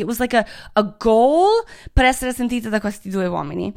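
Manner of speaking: normal talking voice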